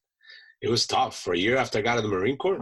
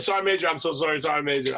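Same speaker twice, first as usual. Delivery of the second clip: higher-pitched, mock-frantic apologizing; flustered apology; 5 semitones higher, faster than usual. Young Marine apologizing to his sergeant major, mock flustered. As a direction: apologize like a rattled subordinate.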